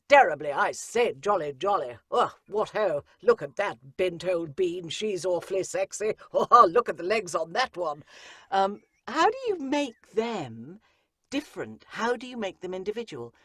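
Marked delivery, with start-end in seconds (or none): 0.1-8.0 loud, braying toff voice; posh young men at a ball, loud and hearty; upper-class twits. Retold or imitated. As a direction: bluster like a jolly chap ogling girls. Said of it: "how do you make the men, who are all terribly".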